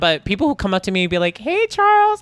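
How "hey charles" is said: very high, put-on greeting voice; high-pitched greeting. Acquaintance coming up to say hello, high-pitched. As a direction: imitate a gushing hello, way up high. Voicing people who greet the speaker.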